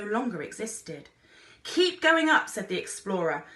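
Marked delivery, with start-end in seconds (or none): 1.6-2.5 high, loud call; shouted order to climb; shouted command. A narrator's reading. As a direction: yell it over the wind. Explorer shouting instructions.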